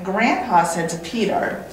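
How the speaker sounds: ordinary delivery, nothing marked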